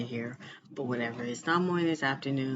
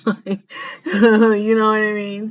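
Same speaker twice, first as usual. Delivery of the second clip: laughing; 7 semitones higher, 12 dB louder than usual. Real, not acted. Laughs mid line.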